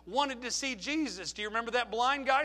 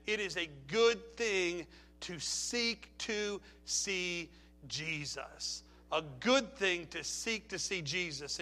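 tender reverent; 3 semitones lower, 5 dB quieter, slower than usual. Acted. Preaching climax, slow.

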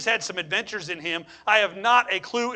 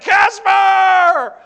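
high, loud panicked yell of Casper; terrified shout; 11 semitones higher, 13 dB louder than usual. Retold or imitated. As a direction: scream it like you've seen a ghost. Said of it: "They're like".

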